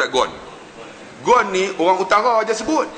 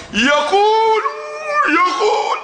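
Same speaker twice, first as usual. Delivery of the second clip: crying out; much slower than usual. Retold or imitated. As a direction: cry out the word like the congregation.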